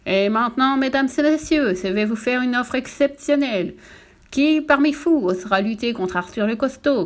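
harangue du public, appuyée et un peu plus haute; boniment de cirque. Acted. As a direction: vendez le défi à la foule. Le directeur défie le public. (le directeur défie la salle, ton de bonimenteur)

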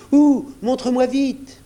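high, loud, impatient cry; excited exclamation. A narrator's reading. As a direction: demand it eagerly at high pitch. Sajo urgently searching for father.

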